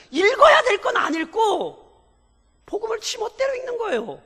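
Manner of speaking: pastor rebuking the congregation's careless reading, at full force; fiery preaching. Acted. Preacher urging the church to see the Bible's meaning, shouting with fervour.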